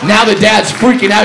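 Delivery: normal talking voice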